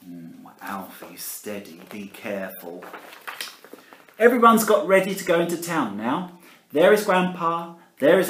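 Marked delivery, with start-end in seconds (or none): 0.1-2.8 hushed warning aside; whisper. Acted. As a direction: whisper a caution to Alfie. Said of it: "whispered warning".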